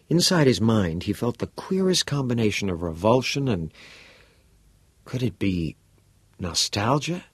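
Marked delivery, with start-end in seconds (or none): none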